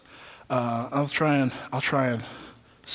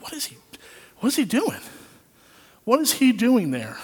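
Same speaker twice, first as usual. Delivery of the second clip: higher, bewildered asking; baffled wonder; 8 semitones higher than usual. Retold or imitated. Preacher retells asking himself about the Spirit, baffled. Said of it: "And I'm like".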